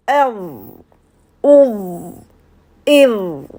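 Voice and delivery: "Ev, ouv, iv" with a heavy dark TH buzz dying away; thicker, graver ending. Shown as a demonstration. Teacher repeats the heavy letter, grave "Ev, ouv, iv". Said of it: "plus épaisse et plus grave".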